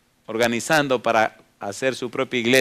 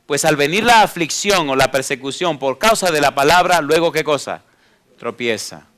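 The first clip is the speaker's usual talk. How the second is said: preaching at full force; 3 semitones higher, 5 dB louder than usual. Acted. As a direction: drive the verse home to the flock. Preaches the second kind of soil. (predicando con fuerza)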